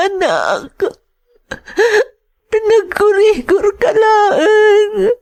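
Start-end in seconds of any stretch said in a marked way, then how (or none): 2.5-5.1 slow, tearful lament; mother sobbing at child's bedside; crying mother. Acted. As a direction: weep over the sick child, slowly. Mother crying by hospital bed.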